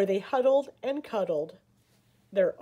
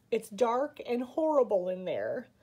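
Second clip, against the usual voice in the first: ordinary delivery, nothing marked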